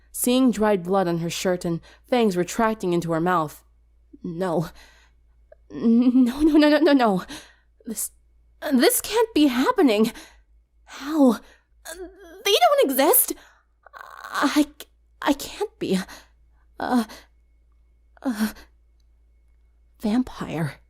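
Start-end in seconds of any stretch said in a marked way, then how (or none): 4.2-7.2 spiral into horrified denial; panicked denial. Acted. Sunset sees fangs in the mirror. 7.9-16.0 high, shaky disbelieving gasps; horrified disbelief. Acted. Sunset realizing she is a vampire, horrified and high. 16.8-20.8 stunned whisper. Acted. Sunset names what she is.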